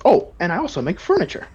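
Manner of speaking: cheery shopkeeper add-on; proud merchant. Acted. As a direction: slip in another sale. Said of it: "He says".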